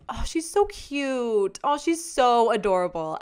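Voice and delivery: hammed-up adoring gush; imitated gushing admirers. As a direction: coo like people fawning over someone cute. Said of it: "most people's first impression of you is".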